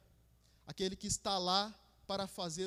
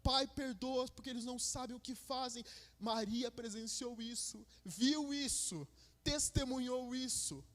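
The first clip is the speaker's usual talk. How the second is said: high, loud preaching at full force; fervent preaching; 8 semitones higher, 3 dB louder than usual. Acted. Preacher to congregation on Easter night, full fervent preaching. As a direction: proclaim it with rising fire.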